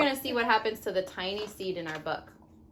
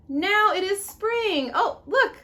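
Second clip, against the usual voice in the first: higher, slow, louder cheer; bright spring; 8 semitones higher, 4 dB louder, slower than usual. Acted. Mom reading spring arriving, delighted and bright.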